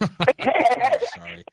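laughing. Real, not acted. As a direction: say it mid-laugh. Apologizing through a laugh.

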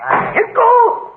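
high, explosive order; high-pitched outburst; exasperated yell. Acted. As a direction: yell him out of the room. Guest orders host away.

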